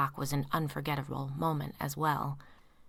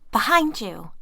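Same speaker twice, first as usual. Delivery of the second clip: louder repeated warning, stress on behind; insist, hitting behind; urgent warning; 5 semitones higher, 9 dB louder, slower than usual. A narrator's reading.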